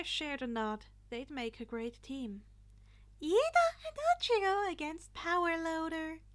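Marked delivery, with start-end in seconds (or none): 3.2-6.2 high, loud chirpy announcing voice; cheerful principal. A narrator's reading. Principal announcing the teams to the class, high cheerful call. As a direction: announce it like a bubbly host.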